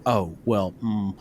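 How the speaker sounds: lightly voiced pastor caught without an answer; awkward pastor. Pastor stuck on a hard question in the men's group, awkward and soft. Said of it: "the pastor was sitting there like".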